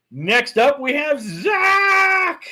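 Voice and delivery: slow, high, loud announcer call; drawn-out announcer shout. Acted. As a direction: stretch the name like a hype host.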